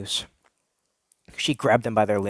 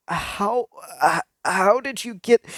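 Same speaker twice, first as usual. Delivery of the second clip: nervous stammer; 7 semitones higher, slower than usual. Acted. Nervous question to Abby.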